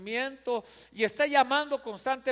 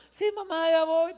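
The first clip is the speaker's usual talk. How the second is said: put-on placating kid; 7 semitones higher than usual. Son placates mother.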